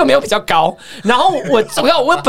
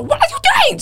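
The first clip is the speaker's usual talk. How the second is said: high sharp mock teacher yell in English; yelled English quote; slower than usual. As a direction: shout it up high like the angry teacher. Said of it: "他就英文就说".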